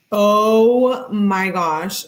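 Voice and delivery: a real slow gasp of disbelief; stunned oh my gosh. Real, not acted. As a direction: let it sink in, stretched long and disbelieving. Reacting to the warm milk text.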